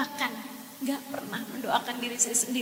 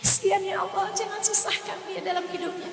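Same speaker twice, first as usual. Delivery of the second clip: retold pleading prayer; 9 semitones higher, 4 dB quieter than usual. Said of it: "Doanya nggak berhenti".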